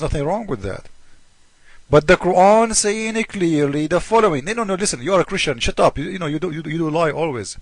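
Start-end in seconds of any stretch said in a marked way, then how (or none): none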